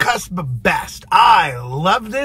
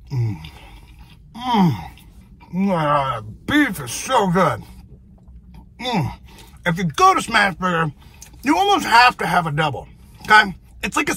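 chewing while talking; talking between bites; mouth full; 2 semitones lower than usual. Real, not acted. Seen 0: Bites at -2, closed-mouth chewing then talking through food.

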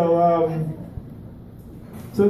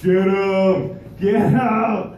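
mock egging on; 5 semitones higher, much slower than usual. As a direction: cheer on the fight in mimicry. Onlookers egging on a fight, acted.

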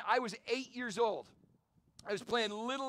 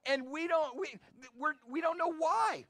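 ordinary talk, nothing marked

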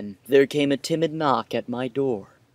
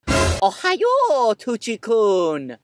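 put-on anime girl greeting; 7 semitones higher, 3 dB louder, much slower than usual. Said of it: "character greeting quote".

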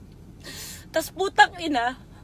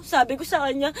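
usual speaking voice

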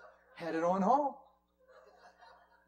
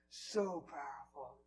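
ordinary talk, nothing marked

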